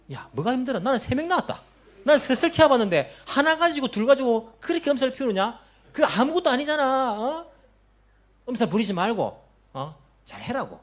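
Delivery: impatient, scornful lecture; belittling scold. Retold or imitated. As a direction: play the veteran mother who sneers at the young one. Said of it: "이렇게 말하시는 분 있습니다".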